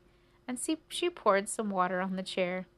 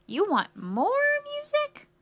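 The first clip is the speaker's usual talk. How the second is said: very high, loud squeal, stretched out; delighted cricket mishearing; 6 dB louder, slower than usual. Acted. Cricket mishears the mouse again, gleefully high and loud. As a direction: swoop way up and hold MORE.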